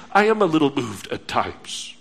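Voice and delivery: a lightly voiced self-excuse; mock hearer. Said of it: "But maybe you say".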